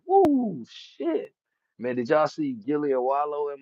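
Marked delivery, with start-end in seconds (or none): 0.1-1.3 high, loud excited whoop; a quick high celebratory cheer; hyped victory whoop. Retold or imitated. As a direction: let it rip like a fighter walking out after a knockout win. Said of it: "They wanna walk out that bitch like".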